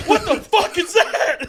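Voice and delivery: dad shouting while the teller laughs; furious dad. Retold or imitated. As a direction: roar it like an outraged father. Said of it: "He goes".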